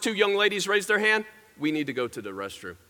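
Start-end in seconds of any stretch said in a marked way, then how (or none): 1.6-2.8 low, softly voiced pupil asking permission; meek pupil request. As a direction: ask the teacher quietly, pitched down. First young ladies ask permission.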